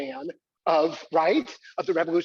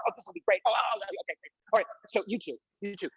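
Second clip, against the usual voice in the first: ordinary talk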